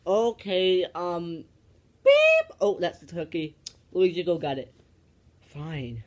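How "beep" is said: very high, loud electronic beep; mock timer beep. Host imitating the oven timer for the turkey, piercing beep. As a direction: squeak it out like a kitchen timer going off.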